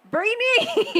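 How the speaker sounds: high, sharp call of her name, like a scolding dad; dad yelling her name. Retold or imitated. Dad catching her on the show, yelling "Brittany!". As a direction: shout her name like a caught-out father. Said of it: "my dad was like".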